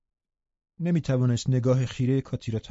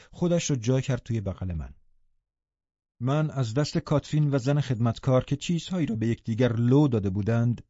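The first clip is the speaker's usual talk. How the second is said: plain reading voice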